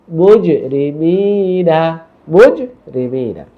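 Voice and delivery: মুজরিমিনা very slow, a bounce on j and a long "mee"; correct recitation demo. Shown as a demonstration. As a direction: recite মুজরিমিনা drawn out with an echoed j. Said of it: "শব্দগুলো শুদ্ধ করে পড়ে যাচ্ছি". (মুজরিমিনা ধীরে টেনে তিলাওয়াত)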